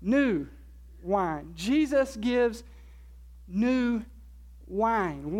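"new" isolated between pauses; slow teaching emphasis on "new", set apart by silences; stress on new. Shown as a demonstration. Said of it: "with the emphasis on the word new".

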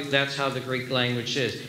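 ordinary talk

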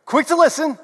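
quick and bright. Shown as a demonstration. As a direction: fire it off high and quick. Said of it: "say it with me and do the hand motions".

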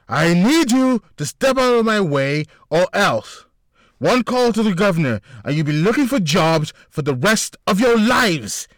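high, loud threat, slowing at the end; screaming threat. A narrator's reading. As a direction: threaten the bouncers at full volume. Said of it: "screaming at the men".

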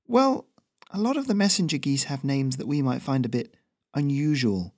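high, easygoing chatty messenger; Ferrino's voice. Acted. Messenger reassures the nervous local, high and easy.

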